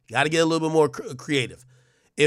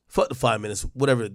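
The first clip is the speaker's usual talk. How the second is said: usual speaking voice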